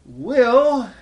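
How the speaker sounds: high, loud plea; sick leper begging Jesus to heal him; pleading leper. Acted. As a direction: beg like a dying leper. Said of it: "he simply said".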